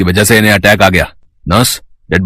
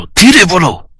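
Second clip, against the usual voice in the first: "धीरे बोलो" sharply raised in pitch; insistent hushing; 10 semitones higher, much slower than usual. Acted. Doctor silencing the angry nurse, insistent. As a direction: repeat the order urgently, voice jumping high. Repeats the hush more forcefully.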